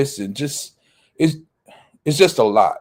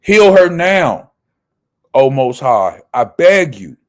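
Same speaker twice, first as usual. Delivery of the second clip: crying out to God; 6 dB louder than usual. Acted. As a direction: beg God aloud to heal his sister. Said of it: "And Moses cried unto Yahweh".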